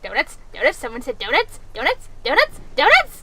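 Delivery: mock excitement climbing with each repeat; mounting donut frenzy. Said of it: "That was like".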